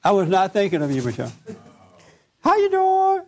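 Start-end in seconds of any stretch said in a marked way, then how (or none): 2.4-3.2 very high, slow falsetto greeting; hammed-up high sweet clerk voice. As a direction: put on a dainty customer-service voice. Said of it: "doesn't it sound like Michelle".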